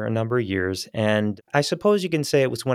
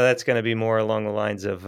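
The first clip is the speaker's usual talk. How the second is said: ordinary delivery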